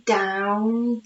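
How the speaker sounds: ordinary delivery, nothing marked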